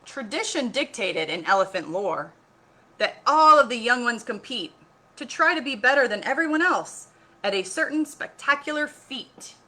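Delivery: story reading. A narrator's reading. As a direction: build the story with excitement. Reads picture book aloud.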